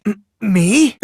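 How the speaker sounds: startled high stammer; flustered stammer. Acted. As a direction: act caught red-handed. Caught out, stammering.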